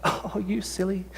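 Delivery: soft, slow, mock-serene brush-off; a gentle, pretend-unbothered "you silly"; mock calm. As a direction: pretend to shrug critics off sweetly. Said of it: "calmly say".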